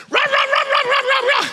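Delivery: high, loud mock barking; barking dog. Pastor imitates his dog going off at the skunk, frantic barking. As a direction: bark frantically like a worked-up dog. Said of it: "my dog starts to".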